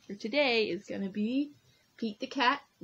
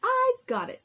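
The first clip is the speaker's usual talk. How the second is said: excited; 8 dB louder than usual. Acted. Pete's sudden idea.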